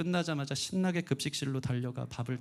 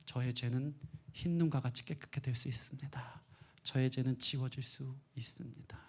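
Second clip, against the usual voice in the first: hushed whisper; 3 semitones lower, 7 dB quieter than usual. Acted. Preacher's whispered assurance.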